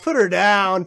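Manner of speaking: loud joking order to put her down; mock command. A loud mock order about the girl. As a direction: bark it like a cop with a target.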